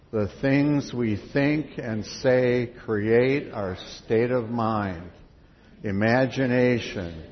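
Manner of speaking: slow, loud, measured recitation; reading out loud together. Real, not acted. Leader reads songbook words aloud with the congregation, slow loud recitation. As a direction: read it out in unison, drawn out and strong. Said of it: "Read it out loud".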